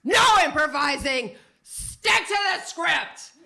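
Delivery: loud, high shout, slowing on the script; furious director. Retold or imitated. Music director scolding the chorus, loud angry shout. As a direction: bark it like an enraged teacher. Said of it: "slams his hand down on the piano".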